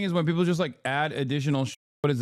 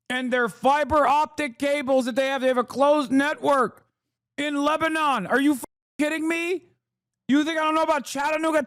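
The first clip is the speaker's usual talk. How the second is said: indignant rant; 9 semitones higher than usual. Real, not acted. Ranting about his internet knowledge.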